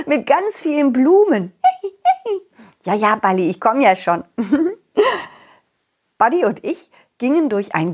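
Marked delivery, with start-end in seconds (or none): 1.6-2.4 very high squealing giggle; high squeal laugh. Acted. Puppet laughs.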